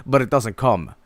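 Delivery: ordinary talk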